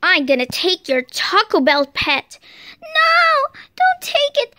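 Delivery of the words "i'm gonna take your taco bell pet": low robber voice. Pretend. Robber threatens pet.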